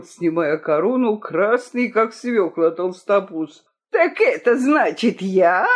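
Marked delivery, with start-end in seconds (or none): 3.9-5.7 offended hissing question; hissed. A narrator's reading. Accused king facing his rival, hissing indignantly. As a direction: hiss it at your rival, affronted. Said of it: "прошипел Цинамон".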